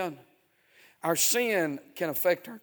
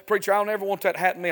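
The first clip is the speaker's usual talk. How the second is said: quick, louder, higher plea; imitated young churchgoer; 6 semitones higher, 9 dB louder, much faster than usual. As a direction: rattle off a young believer's promise to the preacher. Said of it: "you young people say".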